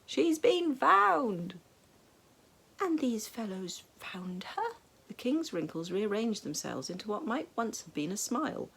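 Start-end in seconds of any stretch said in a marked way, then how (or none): 0.0-1.6 loud, high, very slow shout; bellowed. A narrator's reading. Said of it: "bellowed the attendant". 2.8-4.8 whisper. A narrator's reading. The king's hushed wondering question.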